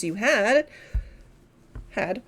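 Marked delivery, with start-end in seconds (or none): none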